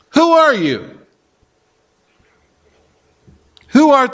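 a hint of pushy interrogators; mock questioning delegation. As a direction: press him for an answer, acting out the delegates. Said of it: "they repeat the question".

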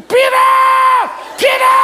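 high, loud scream for help; screamed call. Retold or imitated. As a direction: scream the name out into the forest. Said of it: "I was screaming".

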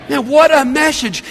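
ordinary talk, nothing marked

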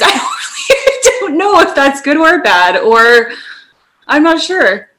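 cracking up, words squeezed out slowly through laughter; helpless real laughter, barely getting words out; laughing hard. Real, not acted. Host reacting to her co-host's nerd impression, laughing hard.

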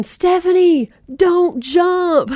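bullhorn yell. Retold or imitated. Rescuer on a helicopter bullhorn calling to Stephanie, mock yell. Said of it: "he starts yelling".

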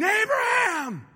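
passionate cry. Retold or imitated. Angel crying out to Abraham, passionate shout. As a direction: cry out to stop a man in his tracks. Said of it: "think of the passion of the cry saying".

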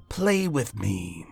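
slow, low eerie coaxing; icy luring voice. A narrator's reading. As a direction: beckon the child slowly. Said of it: "an icy voice".

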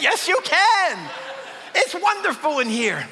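high, loud, giddy excitement; overjoyed sheep. Acted. Sheep loving life inside the pen, overjoyed. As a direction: gush with glee, leaping up on each answer.

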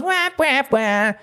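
high mock crybaby wail; mock whining. Host imitating the complaining rider, high crybaby wail. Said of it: "And he goes".

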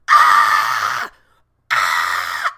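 loud squealing rat shrieks; rat screaming. Retold or imitated. The cornered rat screams as the door closes, loud panicked squeals. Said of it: "that mouse was just like".

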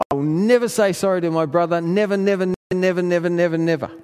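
imitating a furious kid shouting never; retold shouting. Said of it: "saying this out loud at the top of his voice".

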